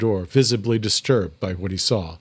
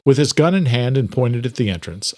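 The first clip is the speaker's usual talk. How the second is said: ordinary delivery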